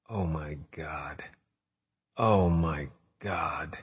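hushed, slow, horrified; horrified whisper. Acted. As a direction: breathe it out in shock. Horrified at friend's corpse.

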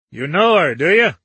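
put-on gruff old man demanding an answer; suspicious challenge. A narrator's reading. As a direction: press him hard. Dickinson confronting Weldon.

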